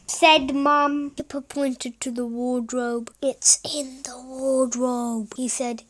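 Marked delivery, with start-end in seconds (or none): 3.2-5.3 slow, secretive whisper. Acted. Boy whispering to mum where the monster hides. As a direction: whisper it so the monster won't hear.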